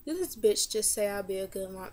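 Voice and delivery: usual speaking voice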